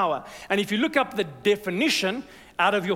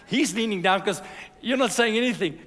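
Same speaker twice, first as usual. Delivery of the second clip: normal talking voice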